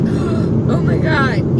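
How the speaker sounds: slow breathy gasp of fright; stretched frightened gasp; gasped oh my God. Retold or imitated. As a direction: gasp it out like your heart just sank. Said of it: "like that kind of a feeling".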